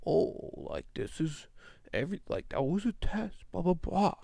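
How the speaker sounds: put-on light English accent, soft and airy; a hint of a breezy English gent. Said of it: "an English dude just being like".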